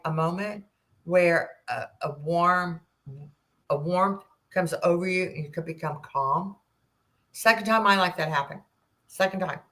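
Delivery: usual speaking voice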